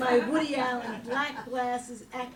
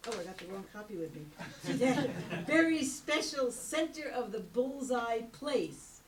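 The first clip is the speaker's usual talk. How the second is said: ordinary delivery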